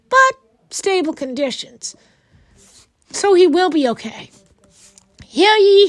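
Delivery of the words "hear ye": Hear ye called out loud and high; town crier. Acted. Town crier announcing Bridgerton news.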